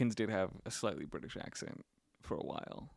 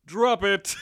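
loud, high robot cop command; RoboCop; 8 semitones higher, 6 dB louder than usual. Acted. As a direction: order them like a mock robot lawman. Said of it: "as RoboCop would say".

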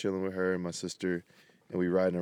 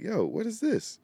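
high, startled "what is this"; puzzled high yo; 14 semitones higher than usual. Retold or imitated. To himself when his music stops, a high startled question. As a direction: pitch it up like you just caught something odd. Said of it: "I was like".

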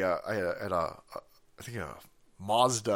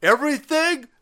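high-pitched mock news anchor; hammed-up cable news host; Cuomo; 8 semitones higher, 3 dB louder than usual. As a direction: imitate a TV anchor, pitched way up and drawn out. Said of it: "I think that was Cuomo".

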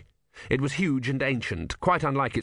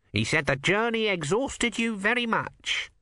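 lightly voiced nervous cleric, raised pitch; a fussy young monk, pitched up; high fussy monk; 10 semitones higher than usual. A narrator's reading. Numrod voiced by narrator.

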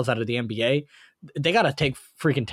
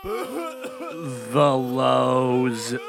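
mock dismay. Mock-dramatic groan at "lows.

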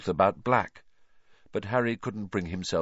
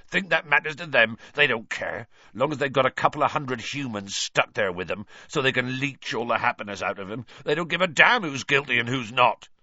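bitter; 5 semitones higher than usual. Acted. Hagrid bitter.

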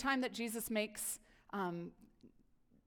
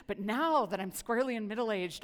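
usual speaking voice

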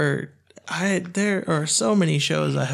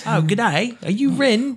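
high, louder hammed-up Australian greeting; mock Australian accent; 9 semitones higher, 4 dB louder than usual. Host family greeting the boy, hammed-up Australian accent. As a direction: lay on a cheery Aussie host voice. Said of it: "mimicking Australian host family".

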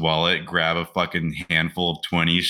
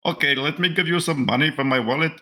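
put-on high-pitched caricature of an Indian accent; mock Indian accent; 8 semitones higher, faster than usual. Indian prince offering money from his wallet, mock Indian accent. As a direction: play a naive student with a put-on Indian accent. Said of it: "giving this guy an accent".

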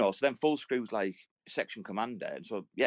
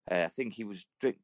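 ordinary talk

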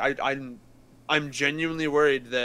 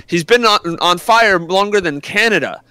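loud, higher roast punchline; comic punchline delivery; 5 semitones higher, 10 dB louder than usual. Retold or imitated. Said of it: "he's dead ass gonna be like".

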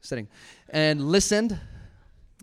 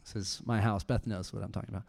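ordinary delivery

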